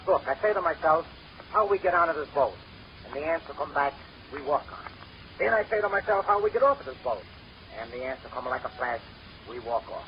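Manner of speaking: put-on thick comic Italian accent, broken English. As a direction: play the Italian immigrant sidekick with a heavy Italian accent. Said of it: "a little fellow with an Italian accent".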